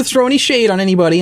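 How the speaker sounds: ordinary talk, nothing marked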